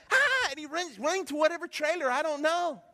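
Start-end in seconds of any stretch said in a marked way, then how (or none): 0.1-0.5 high excited yelp; excited boy's cry. Retold or imitated. Pastor imitating an excited boy with a coloring book, high yelp. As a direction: squeal like a thrilled little boy. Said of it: "he's so excited".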